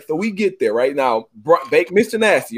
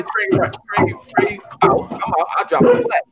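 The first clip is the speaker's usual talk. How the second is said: excited. Real, not acted. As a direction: pitch way up, excited. Excited retelling of the push.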